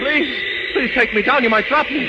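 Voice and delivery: high, anxious begging; frightened pleading. Acted. Doctor begging Superman to take him down, frightened pleading. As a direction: beg in a high voice, afraid of falling.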